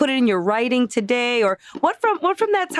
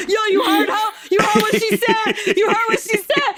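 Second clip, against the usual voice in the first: imitating a thrilled father bragging; excited proud dad; 6 semitones higher than usual. Friend imitating Ulises bragging about his daughter, hyped. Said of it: "he'd be like".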